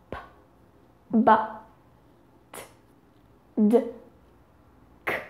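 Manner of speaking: P, t, k as voiceless bursts, b, d with voice; P, b, t, d, k as separate sounds, alternately unvoiced and voiced; voiceless and voiced pairs. Shown as a demonstration. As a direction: sound out P, b, t, d, k, each alone. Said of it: "Say each sound with me".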